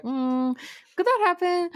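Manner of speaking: high, sudden curious question; mock worried wondering; puzzled wondering. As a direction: voice the doubt as it popped into mind. Wondering thought quoted, voice jumps up.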